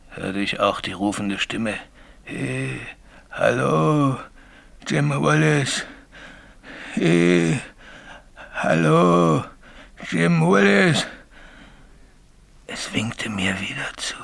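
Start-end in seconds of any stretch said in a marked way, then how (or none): 2.2-11.1 eerie call climbing higher; ghostly call. Retold or imitated. Signalman mimics the ghost calling again, eerie. 12.7-14.2 barely voiced dread; whispered. Signalman whispers how the ghost waved, hushed.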